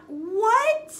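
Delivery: ordinary delivery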